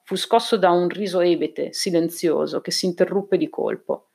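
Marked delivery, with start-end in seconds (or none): none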